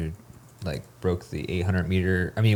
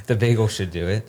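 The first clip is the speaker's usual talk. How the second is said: laughing; 3 semitones higher, 5 dB louder than usual. Real, not acted. Laughing at bagel joke.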